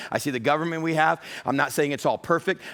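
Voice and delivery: ordinary talk, nothing marked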